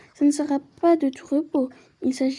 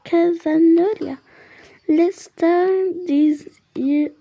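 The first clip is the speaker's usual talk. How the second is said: put-on French accent in English; 3 semitones higher, much slower than usual. Player struggling through English with a French accent. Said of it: "bonjour mon accent".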